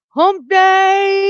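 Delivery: high, slow, loud stretched-out shout; hump day call. Acted. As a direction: drag it out like a goofy ad. Hump day morning show gag.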